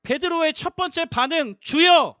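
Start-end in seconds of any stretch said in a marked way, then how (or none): 1.6-2.2 loud, higher cry to the Lord. Retold or imitated. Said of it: "소리 질러 이르되". (주님을 크게 부르짖듯)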